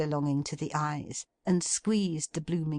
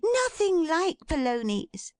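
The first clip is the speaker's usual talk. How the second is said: high, laughing dismissal; giddy with the game; delighted Meg; 13 semitones higher, 3 dB louder than usual. A narrator's reading. Meg delightedly waves off his guess.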